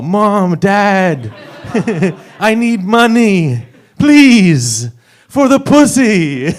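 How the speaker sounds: loud, drawn-out mock begging; pleading son. Son calling parents for money, hammed-up pleading. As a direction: plead slowly and high, like a needy kid.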